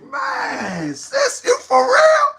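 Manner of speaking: high, slow, shouted disbelief; incredulous cry. Retold or imitated. Host retelling what he asked the caller, high incredulous cry. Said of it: "I was like".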